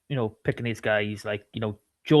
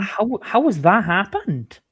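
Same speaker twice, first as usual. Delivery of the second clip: pitched up high, slow and baffled; can't believe what he's hearing; shocked disbelief; 7 semitones higher than usual. Real, not acted. Stunned by the Pontypool transfer news, asking in shocked disbelief.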